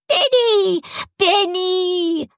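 put-on very high, squealing small-boy voice; piping child's cry, last vowels held; little child. A narrator's reading. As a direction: call out like a relieved little kid. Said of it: "Little Toby crawled out".

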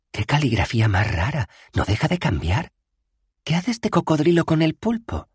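put-on high, clear young woman; Bethan's voice. Bethan pointing out the bad spell, high and firm. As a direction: point it out firmly.

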